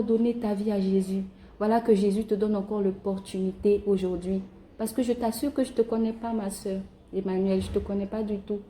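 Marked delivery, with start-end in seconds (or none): none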